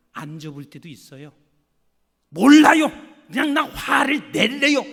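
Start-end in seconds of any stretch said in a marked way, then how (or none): 2.3-4.8 loud, higher angry shout; angry outburst. Retold or imitated. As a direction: yell it like losing your temper. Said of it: "하고 그냥 화를 확 냈어요".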